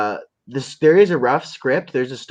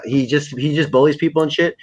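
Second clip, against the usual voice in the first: usual speaking voice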